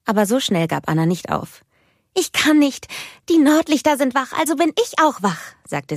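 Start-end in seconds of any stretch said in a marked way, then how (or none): 2.1-5.5 put-on high, loud child's voice; little sister coaxing big sister to play; eager little girl. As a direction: plead like an excited small child. Little Anna begging her sister.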